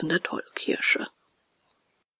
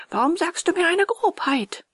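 higher, indignant snap at the carpenter; angry snapping; 9 semitones higher than usual. A narrator's reading. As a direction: snap it at him, offended. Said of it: "fuhr den Schreiner wütend an". (wütend angefahren)

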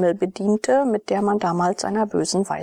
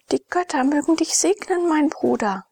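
mildly joyful, higher-pitched greeting; a touch of gladness, voice lifted; delighted; 9 semitones higher than usual. A narrator's reading. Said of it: "sagte sie erfreut".

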